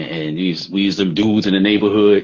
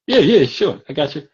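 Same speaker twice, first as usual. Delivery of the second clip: dismissive; 8 semitones higher, 5 dB louder than usual. Retold or imitated. Brushing her off.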